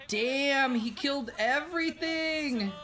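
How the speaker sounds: high-pitched, drawn-out amazement; amazed outburst. Real, not acted. Streamer reacting to a wipeout in the game, amazed.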